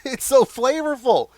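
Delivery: bursting out loud and high after a bite; excited. Real, not acted. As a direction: blurt it out, thrilled by the taste. Tasting, raves about the flavor.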